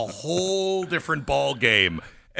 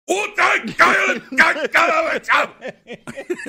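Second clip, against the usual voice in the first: loud, high barrage of harsh German-like gibberish; hammed-up shouted fake German; mock German gibberish shout; 13 semitones higher, 7 dB louder than usual. Host demonstrating a German compliment as mock German gibberish shouting. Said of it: "you just said you were really, really pretty".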